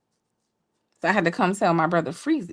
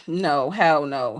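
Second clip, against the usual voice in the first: blunt hell no; 6 semitones lower, much slower than usual. Retold or imitated. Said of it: "it was like".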